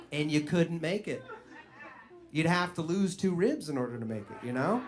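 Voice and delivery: ordinary talk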